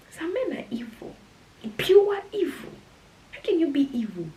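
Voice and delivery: rising indignant cry; indignant outburst. Real, not acted. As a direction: exclaim it in disbelief. Outburst about the evil men.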